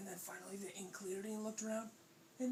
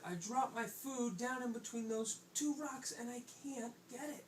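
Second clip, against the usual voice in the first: lightly voiced, high little fish; a hint of helpless complaint; troubled pufferfish; 7 semitones higher than usual. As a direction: explain slowly with a mild whine.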